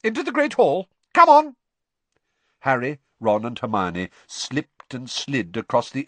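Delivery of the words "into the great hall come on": high, louder brisk order; teacher hurrying a soaked crowd along; sharp McGonagall. Acted. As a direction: snap at the crowd. McGonagall herding students.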